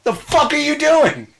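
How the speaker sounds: high, loud, disbelieving yell; high incredulous yell. Retold or imitated. At the man in a Batman costume, a high incredulous yell. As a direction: shout it in outraged disbelief. Retold confrontation, repeated line.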